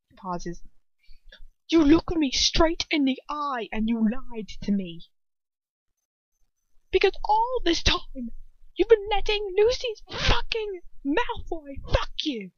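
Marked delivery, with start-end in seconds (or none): 1.7-12.5 accusing, climbing high in outrage; naked anger. A narrator's reading. Ron confronting Hermione about her lies, naked anger. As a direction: throw the betrayal at them, getting higher. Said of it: "shouts of naked anger".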